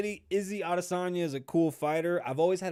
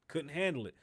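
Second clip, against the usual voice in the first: normal talking voice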